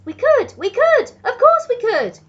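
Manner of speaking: high, loud eager agreement; everyone shouting. A narrator's reading. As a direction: shout yes eagerly, all in a rush of excitement. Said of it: "we all shouted".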